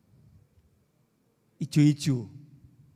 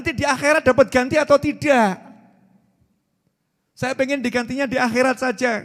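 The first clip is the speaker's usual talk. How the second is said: ordinary talk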